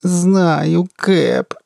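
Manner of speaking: high, drawn-out whining groan; helpless moan. A narrator's reading. Gunner answers her captain, helplessly moaning. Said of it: "беспомощно простонала Джая".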